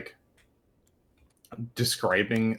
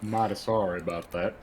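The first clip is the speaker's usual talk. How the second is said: put-on southern accent; 4 semitones lower, 6 dB quieter than usual. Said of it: "practice that sort of accent".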